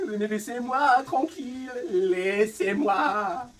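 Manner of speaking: drawn-out, loud, high wail of exasperation; exasperated plea. Real, not acted. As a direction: stretch it out, begging to be left alone. Exasperated streamer pleading.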